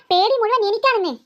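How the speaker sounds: ordinary talk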